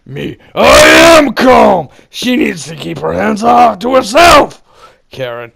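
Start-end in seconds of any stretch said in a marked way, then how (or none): 0.5-4.6 furious high screaming; raging shout at the manager; yelling. Retold or imitated. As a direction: scream that you're calm.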